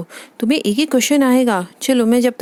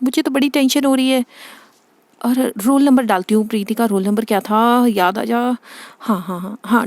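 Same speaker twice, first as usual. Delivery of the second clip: ordinary talk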